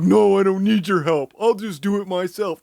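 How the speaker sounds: high-pitched wailing refusal; hammed-up wail, starting loud; mock hysterical protest. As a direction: imitate an over-dramatic roommate insisting tearfully. Acting out the hysterical roommate's protest.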